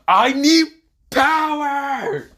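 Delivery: very high, loud, explosive yell; shouted demand. Acted. Hero clenching his fist, high shout for power. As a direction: blast it out at the top of your range.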